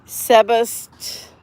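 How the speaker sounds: halting attempt at "Sebastopol"; "Sebastopol" broken up slowly and uncertainly; botched place name. Real, not acted. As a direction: stumble slowly through "Sebastopol". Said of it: "I butchered that name".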